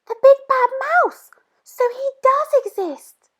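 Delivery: very high, loud startled cry; shocked little monster. Acted. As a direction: gasp it out in fright. Child shocked by the shadow.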